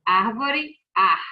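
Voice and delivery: "árvore" loud, then "ar" alone; stressed syllable shown. Shown as a demonstration. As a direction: hit the first syllable of "árvore", then repeat it as "ar". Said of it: "a sílaba mais forte é".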